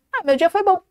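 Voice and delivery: "bom" tossed off lightly with no weight; offhand. Shown as a demonstration. Coach shows the teacher how people downplay a good day, offhand "bom". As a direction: throw away "bom" as if it hardly matters. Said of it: "E quando o dia foi bom".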